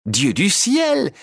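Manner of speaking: high, slow astonished cry; exclaiming. A narrator's reading. Harlequin spots Pinocchio in the audience, astonished. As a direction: gasp it out in wonder. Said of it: "s'exclama-t-il".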